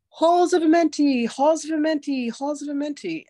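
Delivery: imitated screaming beings. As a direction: echo the spirits' cry, then let it die away. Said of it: "screaming at me".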